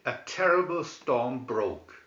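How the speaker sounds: ordinary delivery, nothing marked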